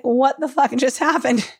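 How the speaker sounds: normal talking voice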